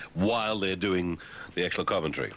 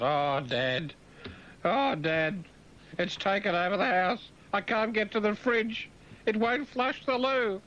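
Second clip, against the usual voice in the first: put-on high, rising, slowing kid's wail; a kid getting more desperate; whining child; 13 semitones higher, slower than usual. A child complains to dad about the V chip, rising whine.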